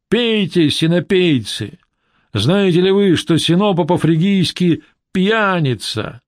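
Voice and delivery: high, slow herald's proclamation; town crier. Acted. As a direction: proclaim it to the drunken crowd. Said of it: "зычным голосом глашатая".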